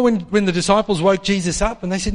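ordinary talk, nothing marked

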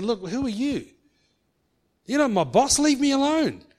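mild mock rude rebuff; snappy rebuff. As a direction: push the newcomer away curtly. Coworker berating them.

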